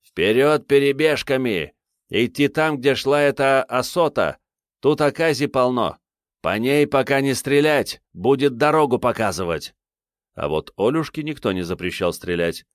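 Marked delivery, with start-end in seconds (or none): 0.0-9.7 higher-pitched shouted commands to his men; shouting orders. A narrator's reading. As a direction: bark out orders, voice raised high. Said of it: "махнул рукой своим и крикнул".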